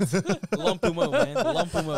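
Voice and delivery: laughing hype call; laughing shout. Real, not acted. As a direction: belt the name out through laughter.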